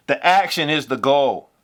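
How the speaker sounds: usual speaking voice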